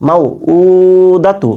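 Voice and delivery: মাউ উ দাতু with quick মাউ and long held উ; short মাউ, stretched উ, quick দাতু; selective elongation. Shown as a demonstration. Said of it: "তাই টান দিব না". (মাউ ছোট, উ টেনে, দাতু ছোট)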